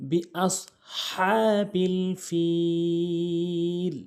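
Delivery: very slow chanted recitation; Quran recitation. Shown as a demonstration. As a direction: draw the verse out melodically. Recites the corrected verse.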